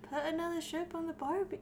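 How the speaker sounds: badly faked Australian accent; failed Australian accent. Shown as a demonstration. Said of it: "No, I can't".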